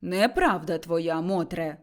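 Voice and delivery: slow, low, unruffled; calm. Acted. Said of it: "спокійно сказав Карпо". (спокійно, низько й неквапом)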